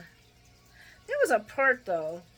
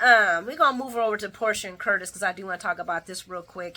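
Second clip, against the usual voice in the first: ordinary delivery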